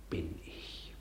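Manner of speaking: barely voiced, whispered self-contempt. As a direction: breathe it out in disgust at himself. Hamlet's self-reproach monologue. (verächtlich geflüstert)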